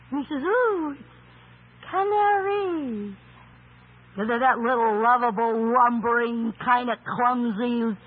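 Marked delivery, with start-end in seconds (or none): 0.4-3.1 higher, slow delighted coo. Acted. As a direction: marvel at the little bird. Adam delighted by a bird.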